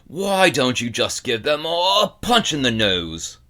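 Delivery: put-on loud, gruff growl of a grumpy old man; gruff old bookseller. A narrator's reading. Old bookseller lectures the boy, loud gruff growl. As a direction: growl it like a cranky shopkeeper who hates children.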